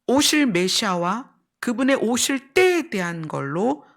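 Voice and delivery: ordinary talk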